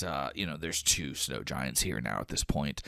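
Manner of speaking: usual speaking voice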